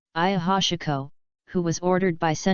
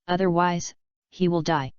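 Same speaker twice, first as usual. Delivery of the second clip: ordinary delivery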